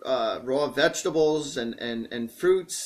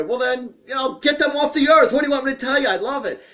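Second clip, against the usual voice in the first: high-pitched, worked-up retort; exasperated, raised-pitch defence; defiant comeback; 7 semitones higher, 4 dB louder than usual. Real, not acted. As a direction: argue back in comic protest. Arguing back at the no-meat advice.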